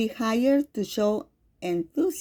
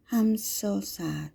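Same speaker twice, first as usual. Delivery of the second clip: sadness; 5 dB quieter, slower than usual. Shown as a demonstration. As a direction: say it slowly and quietly, weighed down. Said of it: "more soft and less energetic".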